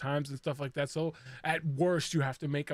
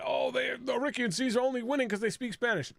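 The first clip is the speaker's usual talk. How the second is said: higher-pitched mimicry of complainers; mocking complaint; 7 semitones higher than usual. Host imitating viewers who grumble about Spanish speakers, mocking. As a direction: rattle it off high, as a dismissed gripe. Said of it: "I don't wanna hear that".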